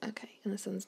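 whisper. Real, not acted. Whispering while playing.

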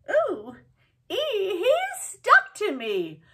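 hammed-up squeal for the kids; mock alarm. Acting bee stuck to her.

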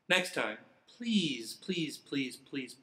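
plain reading voice

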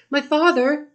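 put-on a child crying out to papa; excited cry. A narrator's reading. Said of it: "cried Layla".

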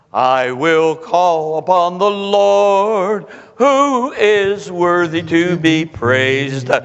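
very slow, measured answer; calm Moses. Retold or imitated. Moses answering the grumbling people, slow and calm. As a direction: let Moses answer slowly and steadily.

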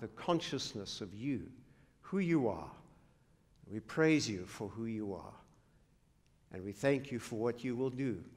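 soft, low pastoral prayer; hushed opening prayer to God. Acted. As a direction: pray quietly and reverently. Opening prayer before sermon.

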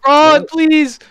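high, loud plea; pleading shout. Real, not acted. Player begs teammate for fuel, pleading shout. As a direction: beg a teammate loudly.

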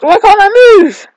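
high, loud cry of frustration; frustrated outcry. Real, not acted. Player protesting stuck controls, frustrated outcry. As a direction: yell it out, pitch shooting up.

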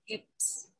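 whispered softly, breath only. Shown as a demonstration. Guiding the group through the decree, whispered. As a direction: let the word hips fall to a bare whisper. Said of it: "You can whisper it".

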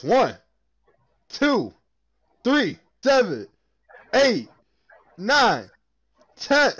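loud, high, spaced-out count. Real, not acted. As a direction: count each one out like a show.

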